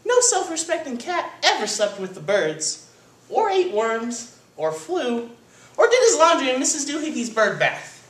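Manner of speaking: high-pitched scolding mother voice; hammed-up indignant mama; lecturing mama. A narrator's reading. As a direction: lecture the kitten, item after item. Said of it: "The lecture went on and on".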